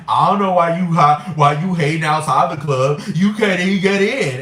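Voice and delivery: loud, inarticulate mocking imitation. Mimicking club insiders taunting a hater, loud inarticulate mockery. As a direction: slur it loudly as a mocking impression. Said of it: "that voice is very not articulate".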